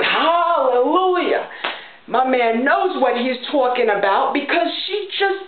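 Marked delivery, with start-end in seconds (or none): 0.0-1.5 slow drawn-out hallelujah, higher; mock cheering hallelujah. Cheering on the TV host, slow hallelujah. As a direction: cheer it like a church choir. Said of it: "I'm just in the background going".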